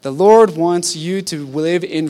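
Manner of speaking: high, loud, slow proclamation; fervent preaching. Real, not acted. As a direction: lift the room with conviction. Preacher rising to full force.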